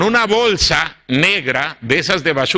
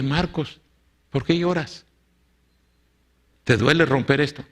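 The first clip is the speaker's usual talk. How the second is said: gentle concern; 4 dB quieter than usual. Retold or imitated. Pastor asks crying Marcos gently.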